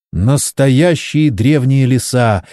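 usual speaking voice

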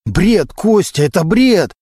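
acted indignant outburst; heated denial. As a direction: throw the accusation back, agitated. Cornered suspect rejects the accusation.

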